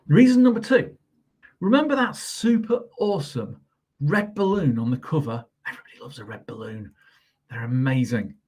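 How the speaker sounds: teacher reading a silly picture book; storybook reading. Acted. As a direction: read it brightly to children. Reads the book's reasons.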